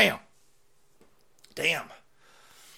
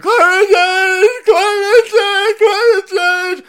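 shrieked slow and high; mocking scream; 15 dB louder, much slower than usual. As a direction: screech it like a protester. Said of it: "you're screaming".

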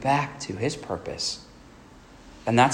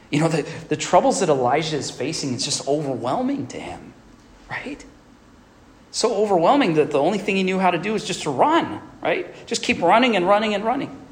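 building fervor; 6 semitones higher than usual. Real, not acted. Preacher building on Elijah's flight.

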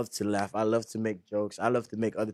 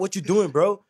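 higher, louder mock jab at a sad brother; teasing challenge; 7 semitones higher, 6 dB louder than usual. Brother teasing his sad sibling, loud mocking jab. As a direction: poke fun at him, half-joking. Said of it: "but I be like".

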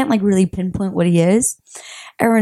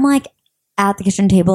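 ordinary delivery

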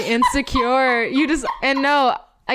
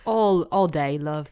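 lightly put-on British accent; playful mock-British, dropping low; 5 semitones lower than usual. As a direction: say it as a jokey Brit. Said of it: "talking in British accents".

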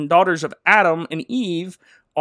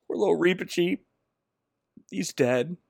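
ordinary delivery

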